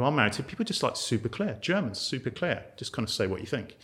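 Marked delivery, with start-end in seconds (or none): none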